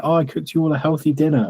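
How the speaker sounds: lightly put-on proud mum; imitating girlfriend's mum. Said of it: "her mum would always get upset".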